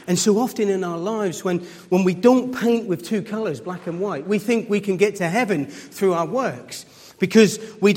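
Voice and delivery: impassioned pulpit preaching; preaching at full force. Acted. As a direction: build the point like a preacher in full flow.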